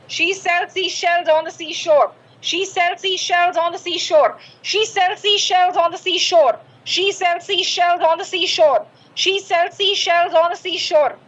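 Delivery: steady chant without pauses, falling at the end; measured tongue-twister drill, smoothly joined; set practice speed. Shown as a demonstration. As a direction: run the phrase together in an even rhythm. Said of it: "इतनी speed से बोलना है".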